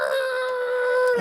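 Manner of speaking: panicked braking yell; mock scream. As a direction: scream as the car lurches to a stop. Screaming while slamming on brakes.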